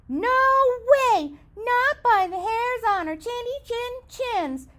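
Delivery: high, squeaky pigs; little pigs crying out. Acted. Three pigs refusing the wolf, high and squeaky. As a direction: cry out high and defiant. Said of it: "cried the three little pigs".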